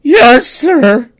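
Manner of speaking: high, cracking, scared yes sir; hammed-up frightened squeak to a mobster; nervous squeak. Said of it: "I was like".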